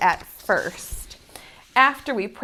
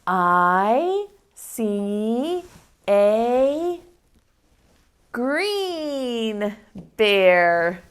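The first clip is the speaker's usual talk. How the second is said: slow choral reading; much slower than usual. Shown as a demonstration. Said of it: "Let me hear you one more time".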